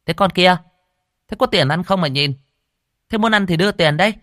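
high-pitched, sharp questioning; brusque food-stall owner. A narrator's reading. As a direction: challenge an unwelcome beggar. Owner questions a loitering girl.

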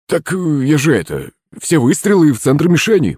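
surprised protest. A narrator's reading. Servant disputes the target score, surprised protest. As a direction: protest as if caught off guard. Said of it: "удивился слуга".

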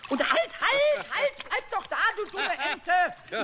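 high, loud, slow cry at the duck; indignant yell. Acted. Pumuckl yelling at the duck that threw him off. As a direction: shout after the runaway duck.

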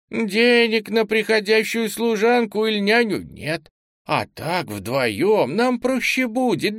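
put-on high chatty old lady; a granny talking on; old woman voice. Old landlady keeps talking.

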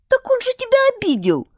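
high, bright little helper declaring; little creature voice. A narrator's reading. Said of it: "заявил малыш". (высокий голосок малыша)

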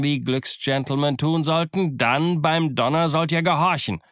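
insistent captain's declaration; commanding. Acted. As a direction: lay down the law, pitch climbing. Captain asserts authority over mutinous crew.

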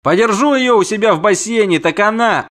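high, loud prince making threats; threatening prince. Acted. Prince threatens to cage the siren, angry.

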